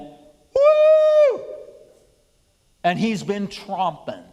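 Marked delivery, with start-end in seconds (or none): 0.0-1.4 loud, very high held woo; Satan's woo. Acted. Preacher acting out Satan let loose on the land, high loud woo. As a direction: let out a shrill, sustained whoop. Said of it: "And Satan went".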